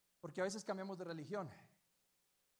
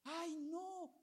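put-on much higher squeal of a thrilled child; excited little girl; 13 semitones higher, much slower than usual. Pastor voicing the little girl over yogurt, excited squeal. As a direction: squeal like a four-year-old seeing a treat. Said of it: "la niña emocionada".

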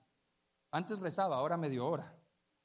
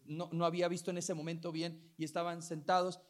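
usual speaking voice

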